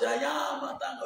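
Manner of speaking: pitched well up, theatrical; hammed-up TV villainess, high; high mock drama line. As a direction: quote the actress in a high hammed-up voice. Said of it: "I said".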